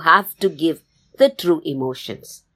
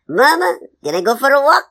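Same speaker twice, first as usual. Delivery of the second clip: high, loud begging cub; pleading baby bear; 9 semitones higher, 5 dB louder than usual. Acted. Baby bear asks mama for permission to walk, pleading. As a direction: plead like a child asking permission. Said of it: "she pleads, she's saying".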